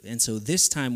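ordinary talk

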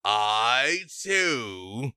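a cocky giant barging into the deal; brash tiefling. Acted. Towering tiefling to the hag, cocky interruption. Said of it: "And he goes".

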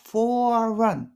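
"for a run" with "for a" merged smoothly; linked for a. Shown as a demonstration. Teacher shows the natural linked sound. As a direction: let "for a" flow into one syllable. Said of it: "こんな感じで言うと".

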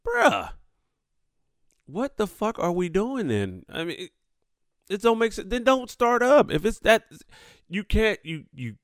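heated disbelief. Real, not acted.

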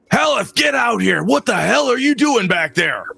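high-pitched outraged yell; angry dad shouting. Acted. Dad calling the innkeeper out, angry shout. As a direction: holler furiously for the innkeeper.

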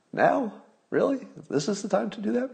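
very high, exaggerated incredulous questions; mock disbelief. Host to viewers about the tweet, in hammed-up high disbelief. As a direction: ham up the disbelief, voice shooting up.

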